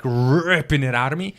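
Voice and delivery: ordinary delivery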